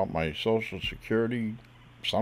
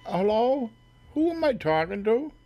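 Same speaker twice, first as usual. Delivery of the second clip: high, raised "anyone there?" call; 11 semitones higher, 4 dB louder than usual. Acted. Prank caller checks who is on the line, calling out. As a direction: call down the line, pitched well up.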